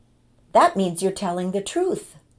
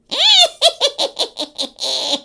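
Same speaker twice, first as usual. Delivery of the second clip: high cackling witch laugh; witch cackle; 6 dB louder than usual. Pretend. Laugh right after witch costume.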